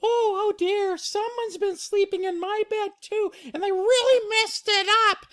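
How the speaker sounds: Mama Bear upset. Acted. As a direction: fret high as the storybook mother. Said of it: "Mama Bear says".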